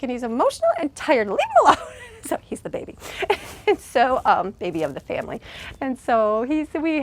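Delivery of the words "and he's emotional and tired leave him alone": scolding. Retold or imitated. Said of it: "I'm like".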